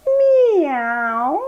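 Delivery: high, rising playful meow; a teacher meowing for the kids in cat pose. Pretend. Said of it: "come up and say".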